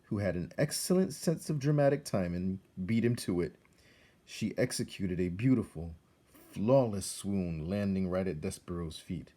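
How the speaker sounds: usual speaking voice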